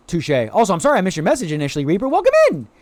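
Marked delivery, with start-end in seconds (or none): 2.1-2.7 high-pitched squealed greeting; excited welcome. Real, not acted. Streamer apologising to Reaper then welcoming them, excited. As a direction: pitch it way up, delighted.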